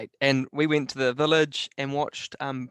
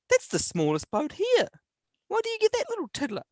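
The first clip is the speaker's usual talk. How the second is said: much higher, scoffing mock-wife voice, faster at the end. Retelling the wife's scoff at his pick in a high mocking voice. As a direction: squeak out her disbelief like a teasing partner. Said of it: "She said".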